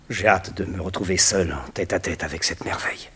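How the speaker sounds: eerie whisper. Acted. Alone with his eerie statue.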